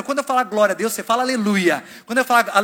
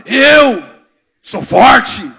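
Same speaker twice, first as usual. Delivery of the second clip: big chest-thumping shout of "Eu sou forte", much louder; manly shout; 12 dB louder, much slower than usual. Retold or imitated. Pastor showing the macho men how to declare it, shouting. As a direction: bellow it like a tough macho man. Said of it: "Machão, fala, homem, fala".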